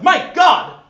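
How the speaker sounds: high, loud frightened gasp; startled shout. Acted. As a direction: yelp it in fright. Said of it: "A voice startles Niles".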